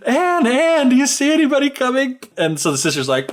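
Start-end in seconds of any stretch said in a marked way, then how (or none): 0.1-2.2 high-pitched mock call; hammed-up calling, pitched way up. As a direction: imitate an urgent call to a sister. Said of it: "She calls out to her sister".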